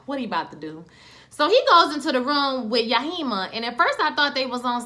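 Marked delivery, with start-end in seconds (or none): 0.1-0.8 wary aside. Retold or imitated. Acted suspicious aside while watching.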